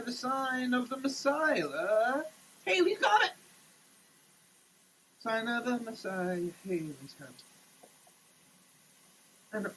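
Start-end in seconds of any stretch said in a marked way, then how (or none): 2.6-3.3 triumphant cry. Acted. Preacher cries out in triumph. 6.7-7.4 muted echo. Acted. Preacher repeats his cry, muted.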